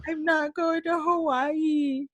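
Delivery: put-on high, slow, sulky whining like a spoiled kid; mock bratty tantrum voice; bratty whine. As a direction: pout it out like a brat. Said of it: "Like a fucking brat".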